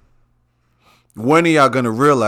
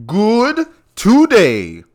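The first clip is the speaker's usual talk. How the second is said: drawn out slow and high, much louder; gleeful; 8 semitones higher, 5 dB louder, much slower than usual. Real, not acted. Host greeting listeners, stretched-out gleeful boast. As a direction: stretch good today out in glee. Host says he feels good.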